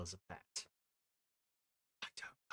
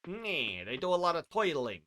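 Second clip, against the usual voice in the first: higher-pitched put-on American gangster accent; mock gangster accent; 5 semitones higher, 4 dB louder than usual. Streamer clowning over the word toidles, mock gangster accent. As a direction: ham up an old-time gangster accent. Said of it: "Don't know what accent this is".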